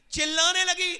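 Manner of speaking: sudden loud high cry of a preacher; rising preaching shout. Real, not acted. Preacher crying out to the crowd about the burning mother, loud. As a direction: hit it hard and high, as if screaming it out.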